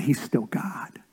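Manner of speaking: hushed whisper for weight. Real, not acted. Pastor whispering that God is still God. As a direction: let it fall to a whisper.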